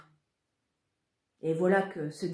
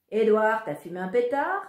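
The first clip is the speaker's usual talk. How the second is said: mocking jibe; 4 semitones higher, 7 dB louder than usual. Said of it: "a lancé mon idiot de frère".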